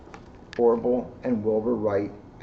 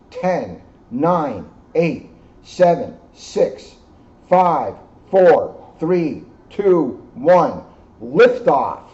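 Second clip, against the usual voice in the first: building countdown; 3 semitones higher, 7 dB louder, much slower than usual. Acted. Reading the countdown to the children, growing excited and louder. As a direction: count down, louder toward liftoff. Narrator reads rocket countdown.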